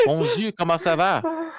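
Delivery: slow, high, hammed-up Québécois French; mock French Canadian greeting, drawn out. Teasing friends, a hammed-up French Canadian greeting. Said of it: "I'm French Canadian. There's my accent".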